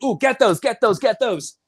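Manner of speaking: retold burst of excitement; excited director. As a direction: urge the crew on excitedly. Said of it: "Michael Mann's like".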